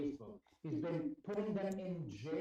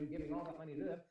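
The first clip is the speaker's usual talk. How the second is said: ordinary talk, nothing marked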